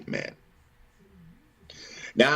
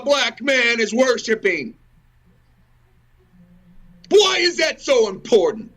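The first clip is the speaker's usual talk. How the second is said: angry outburst; 10 semitones higher than usual. Real, not acted. Ranting at nations meddling in worship, furious shout. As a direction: hurl the questions, outraged.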